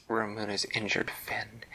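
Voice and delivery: ordinary delivery, nothing marked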